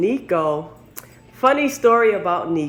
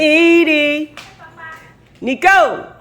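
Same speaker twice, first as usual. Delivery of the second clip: high, loud call of the dog's name; 11 semitones higher, 8 dB louder, much slower than usual. Real, not acted. Calling the dogs by name.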